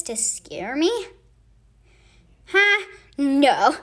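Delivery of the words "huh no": a loud startled huh, then loud no; a loud, startled double-take. Acted. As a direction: jump at the sound. Startled by a noise.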